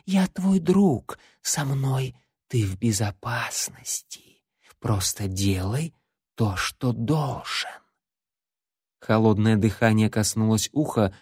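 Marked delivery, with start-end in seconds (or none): 0.1-7.7 slow soft eerie voice; eldritch horror. Acted. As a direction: soothe him like creeping darkness. Shadow reassures Larry.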